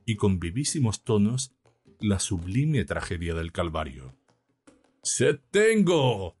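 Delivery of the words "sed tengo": high, very slow, sorrowful intoning; mournful preaching. A narrator's reading. Priest preaching the Calvary to his flock, mournfully intoned. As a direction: draw each word out in lofty grief. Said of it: "prestando a su acento la más triste de las inflexiones".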